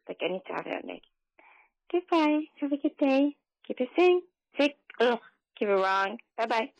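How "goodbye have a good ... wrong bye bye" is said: high, bouncy sign-off, pitched well up; sing-song goodbye. Real, not acted. Caller signing off the show in a high cheery voice. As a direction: wave them off brightly in a raised voice.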